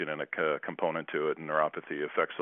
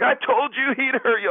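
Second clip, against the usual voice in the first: high, louder put-on teasing voice; teammate teasing; 7 dB louder than usual. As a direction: gloat like a know-it-all teammate. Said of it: "Pepe's like".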